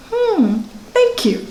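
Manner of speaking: light, high, unbothered "Hmm, thank you"; serene mock thanks. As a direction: answer the insult sweetly, as if it were a gift. Serene reply to insults.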